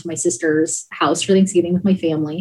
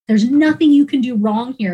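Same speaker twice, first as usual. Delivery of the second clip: ordinary talk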